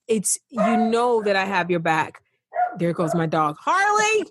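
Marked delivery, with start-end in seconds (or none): 3.6-4.2 high-pitched call to a pet; real interrupted-by-the-dog holler. Real, not acted. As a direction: call out sharply higher to get the dog's attention. Calling out to barking dog.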